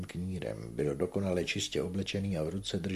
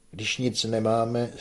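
louder, slower dry statement; dry retort; 5 semitones higher, 7 dB louder, slower than usual. A narrator's reading. Manon answers Papé about the debt, dry retort. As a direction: state it drily and firmly. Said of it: "řekla suše Manon".

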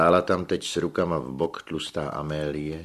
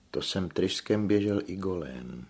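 plain reading voice

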